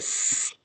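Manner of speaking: sleep pulled apart into long separate sounds; sounded out. Shown as a demonstration. Teacher writing along with pupils, "sleep" sounded out in slow pieces. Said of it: "say the sounds while we write".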